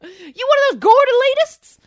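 very high, loud hillbilly voice; mock Southern local defending pumpkin snatching. Continuing the bit.

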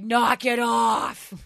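slow drawn-out shout; mom yelling. Retold or imitated. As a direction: bellow it slowly at the party kids. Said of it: "she was like".

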